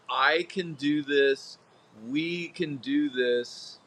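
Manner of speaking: slow, deliberate "I can do this"; slow affirmation, spoken with belief; slow, empowering. Shown as a demonstration. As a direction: let each word land, steady and sure. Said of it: "that is empowering".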